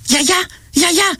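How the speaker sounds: high, slow calling out to grandma; calling grandma with longing; yearning call. A narrator's reading. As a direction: cry out longingly, drawn out. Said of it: "φώναξα και έτρεξα με λαχτάρα".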